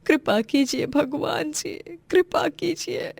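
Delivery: high, anguished plea to God; tearful prayer. Acted. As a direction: beg God through tears, slowing at the end. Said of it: "भगवान से प्रार्थना करती है". (रोते हुए भगवान से गुहार)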